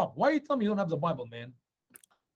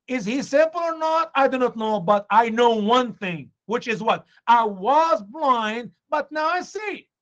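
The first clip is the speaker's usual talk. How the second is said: raised, loud acted testimony; emphatic insistence; 5 semitones higher, 5 dB louder than usual. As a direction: insist as the healed man, leaning hard on the words. Healed man insists to accusers.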